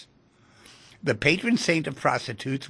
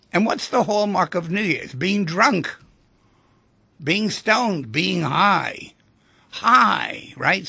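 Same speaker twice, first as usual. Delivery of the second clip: higher and louder, rising to a loud peak on "High"; excited build; 7 semitones higher, 7 dB louder, slower than usual. Acted. Radio host to listeners about New Year's, excited and building. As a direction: pump up the party energy.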